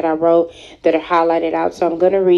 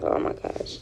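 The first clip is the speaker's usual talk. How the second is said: breathless near-tearful whisper; fighting tears, barely voiced; holding back tears; 12 dB quieter than usual. Real, not acted. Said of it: "I'm trying not to cry".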